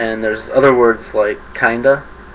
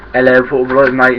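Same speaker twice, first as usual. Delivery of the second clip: a quick, louder mock British accent; 7 dB louder than usual. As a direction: toss off a cheesy British accent. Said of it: "British English".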